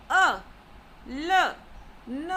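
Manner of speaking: "a", "l", "n" each as a short stressed syllable; falling-tone drill on "a", "l", "n"; fourth tone. Shown as a demonstration. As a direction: punch out "a", "l", "n" separately. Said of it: "The fourth tone".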